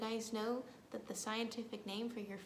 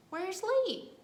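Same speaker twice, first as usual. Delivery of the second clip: calling out; 8 semitones higher, 7 dB louder than usual. Acted. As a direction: call out louder and higher. Calling for the late bird.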